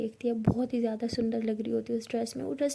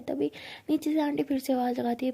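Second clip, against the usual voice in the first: usual speaking voice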